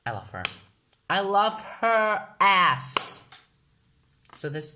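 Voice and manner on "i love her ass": higher, louder, slow drawn-out cheeky gush; slow, loud, cheeky swoon. Acted. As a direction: stretch it out like a cheeky fan. Gushing about her idol.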